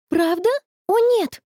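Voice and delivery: high, startled dismay; high-pitched shocked exclamation; exclaiming. A narrator's reading. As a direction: cry out in sudden alarm. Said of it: "воскликнула Конни".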